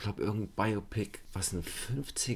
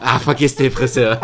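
laughing movie quote; 5 semitones higher, 14 dB louder than usual. Retold or imitated.

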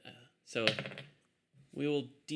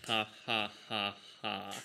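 deadpan "ha, ha, ha, ha" on one note; spoken laugh, evenly spaced and toneless; monotonous laugh; 2 semitones lower than usual. Acted. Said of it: "laugh monotonously, just".

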